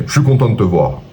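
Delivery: matter-of-fact tone, pitch coming down. Shown as a demonstration. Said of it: "c'est pas tout à fait la même chose".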